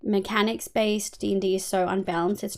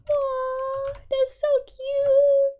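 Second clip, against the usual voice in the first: high, drawn-out cooing, much higher; cooing aww; much slower than usual. Real, not acted. Streamer coos at chat's cute news, high and slow. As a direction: melt over something adorable, slowly.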